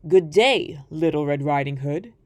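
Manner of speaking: low, sly wolf voice greeting his prey; a wolf sizing up a child. Acted.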